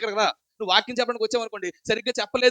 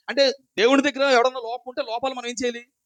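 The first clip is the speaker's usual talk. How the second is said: usual speaking voice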